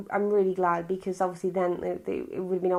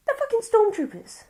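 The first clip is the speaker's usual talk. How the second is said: much higher, louder incredulous cry; exasperated outburst; 4 dB louder than usual. Real, not acted. Reviewer ranting to viewers about copied stormtroopers, high incredulous outburst. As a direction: blurt it out in disbelief, pitched way up.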